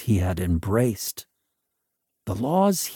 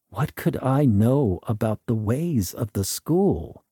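usual speaking voice

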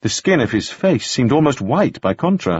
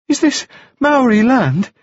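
put-on much higher, bright boyish voice; high bright young gentleman; 14 semitones higher, 4 dB louder than usual. A narrator's reading. Wounded Staines greeting Taufari, high and bright. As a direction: lift it up high and bright like a dazed young toff. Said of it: "speaking in a voice that was high and very bright".

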